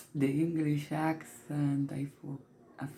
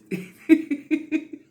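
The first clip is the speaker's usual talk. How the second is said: a louder, higher real giggle; giggling at her own joke; 11 semitones higher, 6 dB louder than usual. Real, not acted. Woman giggles at her own whispered joke, louder and higher. Laughs again.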